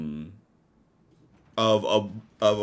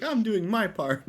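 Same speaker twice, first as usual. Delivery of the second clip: lightly mocked protest, pitched up; Roderick; 9 semitones higher, slower than usual. Game master jokes as the gunman, mock protest. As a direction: draw it out high, half laughing. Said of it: "Roderick's like".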